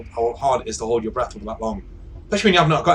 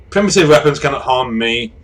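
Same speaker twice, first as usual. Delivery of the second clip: loud, declaimed defiance; imperious device; 3 semitones higher, 7 dB louder than usual. Acted. Device answering a revolver threat, imperious. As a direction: proclaim it as if invulnerable.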